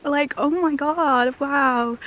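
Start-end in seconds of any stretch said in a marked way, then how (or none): none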